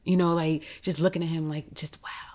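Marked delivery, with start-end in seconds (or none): 1.5-2.3 hushed amazement at his likeness; awed whisper. Real, not acted. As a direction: breathe it out in real wonder. Awestruck watching her father.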